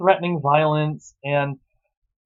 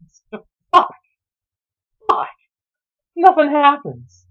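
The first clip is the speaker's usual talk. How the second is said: high-pitched, louder, fed-up groan; exasperated; 10 semitones higher, 5 dB louder than usual. Real, not acted. Reviewer exasperated at a plot where nothing happens. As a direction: let the frustration burst out.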